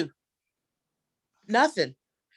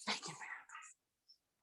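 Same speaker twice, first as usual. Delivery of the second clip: breathy whispered repeat of the punchline; whispered echo; 24 dB quieter, slower than usual. Acted. Comic echoing her own punchline, whispered. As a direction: whisper it to yourself like a secret.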